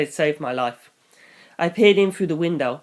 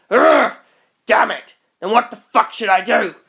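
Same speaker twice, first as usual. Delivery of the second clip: high, loud groan then shout; frustrated outburst; 5 semitones higher, 5 dB louder than usual. Acted. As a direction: burst out in helpless rage.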